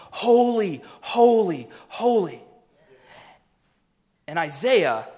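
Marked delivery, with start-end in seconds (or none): 0.1-2.4 higher, louder angelic proclamation, imitated; crying out. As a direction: cry it out like worshipping angels, each word ringing. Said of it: "they're all crying out, they're going".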